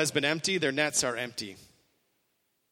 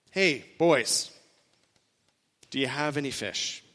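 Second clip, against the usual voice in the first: gentle question; 2 semitones lower than usual. Retold or imitated. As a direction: ask it gently, like a caring friend. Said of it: "it's a gentle question".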